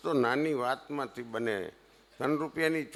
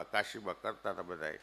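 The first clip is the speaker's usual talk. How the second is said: normal talking voice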